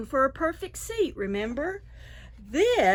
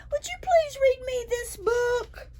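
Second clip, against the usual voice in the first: much higher, hopeful asking voice; pleading dragon. A narrator's reading. Dragon begging the raccoon to read, high and hopeful. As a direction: ask sweetly, hoping for yes.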